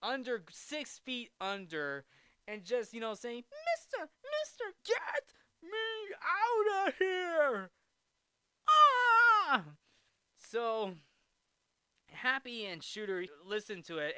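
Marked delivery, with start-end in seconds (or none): 3.5-7.7 high, crying plea from under the grave; trapped ghost lady wail. Retold or imitated. Dead lady calling up from her grave, crying high. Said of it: "she's like". 8.7-9.6 high, very loud shriek; ghostly scream. Retold or imitated. Buried lady shrieking from her grave.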